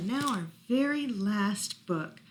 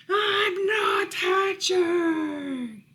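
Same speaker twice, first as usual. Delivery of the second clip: higher, louder, very slow shout; egg's scary shout; 10 semitones higher, 8 dB louder, much slower than usual. Acted. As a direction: bellow it to scare Peep away. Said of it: "He scared the bow right off".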